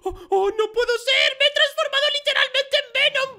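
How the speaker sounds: high, loud disbelief; amazed outburst. Acted. Player discovers he has become Venom, amazed shout. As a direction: build from stunned oh's to a shout.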